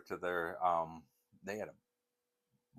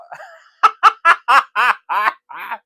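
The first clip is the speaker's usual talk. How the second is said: high burst of laughter; loud laugh; 24 dB louder than usual. Real, not acted. Laughing after impression.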